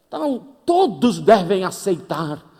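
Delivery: loud, slow pious declaration; mock preachy old lady. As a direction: proclaim it like a sermon.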